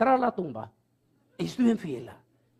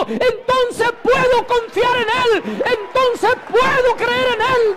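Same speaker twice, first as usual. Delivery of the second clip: much higher and louder, shouted sermon, slowing down on key lines; fiery preaching; 7 dB louder than usual. Acted. Preacher retelling Antonio's conversion to the church, shouting with fervor. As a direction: preach it at full force, building to a shout.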